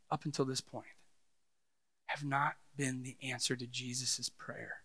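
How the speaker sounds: very soft, low voice with weighty pauses; solemn and hushed. Real, not acted. Preacher admitting failure to the congregation, hushed and solemn. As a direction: bring it right down, grave and earnest.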